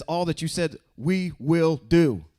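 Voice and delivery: lightly acted solemn promise; people pledging. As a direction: voice the people answering, deliberate. Israel's pledge retold in class.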